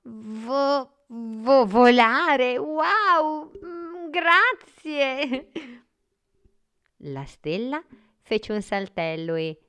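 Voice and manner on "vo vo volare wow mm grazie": put-on loud, high boy stuttering in wonder; astonished child, ending on a long, drawn-out thanks; amazed stammer. A narrator's reading. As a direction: stumble on the first syllable, then burst out amazed. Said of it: "stupito, balbettò".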